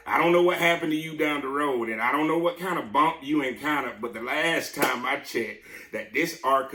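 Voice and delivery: emphatic preaching. Real, not acted. Preacher challenging the congregation about the ark, emphatic preaching. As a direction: preach it out, loud and high.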